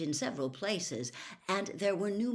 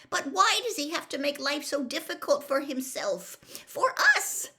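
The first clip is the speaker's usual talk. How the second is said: upset; 10 semitones higher, 4 dB louder than usual. A narrator's reading. Upset Hermione.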